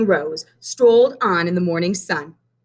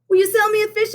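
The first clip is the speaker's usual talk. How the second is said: put-on high, eager little boy voice; young Benny asking; 13 semitones higher, much faster than usual. A narrator's reading. Benny asks Miss Hill for a rose, high and eager. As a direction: ask sweetly like a small boy. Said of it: "asked Benny".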